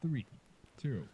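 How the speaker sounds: lightly voiced fight announcer. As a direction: call the round like a game announcer. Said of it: "I love [name]'s voice".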